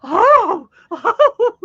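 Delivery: high, loud startled gasp; mock alarm. Wife seeing the fake bump, mock alarm. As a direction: gasp at the sight. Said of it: "And she goes".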